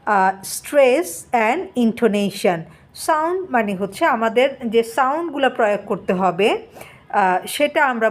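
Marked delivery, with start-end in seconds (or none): 0.1-2.6 very slow, word by word English reading; slow dictation read. Shown as a demonstration. Teacher reading the textbook to students, very slowly. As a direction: read each word out so the class can follow. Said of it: "দ্বিতীয়তে যেটা বললো".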